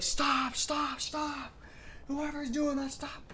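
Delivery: high-pitched mock yell of fed-up coaches; mad coaches. Coaches yelling at kids over a hidden prankster, mock angry. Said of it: "coaches would get so mad".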